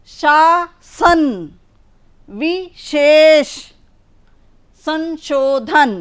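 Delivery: शासन drawn very slowly, sh clearly palatal; शासन, विशेष, संशोधन read slowly, each sh and s crisp; slow sibilant demo. Shown as a demonstration. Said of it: "स और श एक या दो बार प्रयोग".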